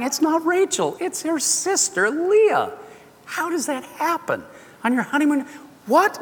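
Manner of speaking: high, incredulous storytelling; amazed disbelief. Real, not acted. Preacher marvelling that Jacob woke beside Leah. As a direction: sell the shock of the switched bride. Retelling the morning-after surprise.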